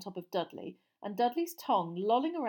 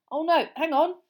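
alarmed; 9 semitones higher, 11 dB louder than usual. Acted. Dropped sweets, alarm.

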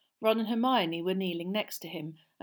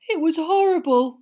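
much higher and louder, shaken; 10 semitones higher, 13 dB louder than usual. A narrator's reading. Neville tells the others after the Dementor, high shaken voice. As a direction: pitch it up, rattled and shaken. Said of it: "in a higher voice than usual".

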